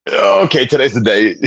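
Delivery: high-pitched "okay" falling back to normal; mock reaction. Telling his haircut moment, mock alarm. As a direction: start high on a startled okay, then settle.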